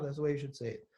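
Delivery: ordinary talk, nothing marked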